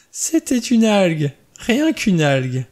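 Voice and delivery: high, loud and slow, laughter still in the voice; hysterical relief. Acted. Vera realizing it was only seaweed, high slow hysterical relief. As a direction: stretch it out, half laughing, half losing it. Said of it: "d'un rire hystérique".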